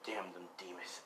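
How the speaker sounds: very soft, low curse; bitter whisper. Acted. Orphaned son cursing demons, bitter whisper.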